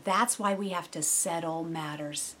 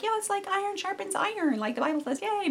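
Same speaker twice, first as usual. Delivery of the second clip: much higher, sing-song mock cheer; mock cheerful; 11 semitones higher than usual. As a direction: fake chipper agreement. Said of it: "you're like".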